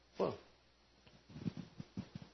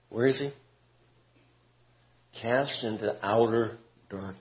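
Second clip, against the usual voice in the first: usual speaking voice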